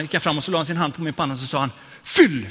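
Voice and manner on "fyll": predikantens utrop. Retold or imitated.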